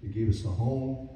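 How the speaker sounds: ordinary talk, nothing marked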